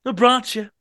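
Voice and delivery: high, loud demand; blurted tipsy demand; drunk man demanding. Acted. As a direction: demand it eagerly. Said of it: "he demanded".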